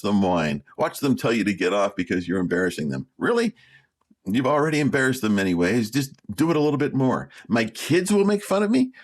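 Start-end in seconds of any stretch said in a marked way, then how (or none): 3.2-3.5 incredulous. Acted. Incredulous reaction. 7.5-8.9 mocking. Mocking repeat of excuse.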